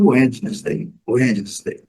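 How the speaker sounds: "Wednesday" as WED-jee-nes-day; old mispronunciation. Shown as a demonstration. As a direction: sound out D and E in "Wednesday". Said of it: "Quando eu comecei a aprender".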